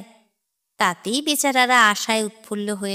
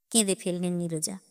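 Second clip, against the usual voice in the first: ordinary delivery, nothing marked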